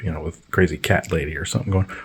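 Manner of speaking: ordinary talk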